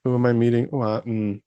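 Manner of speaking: lightly mocked self-important title-holder; self-important boss. Director mocking people whose title went to their head, mock self-important. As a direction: mildly imitate someone puffed up by their title. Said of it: "they take that title power to their head".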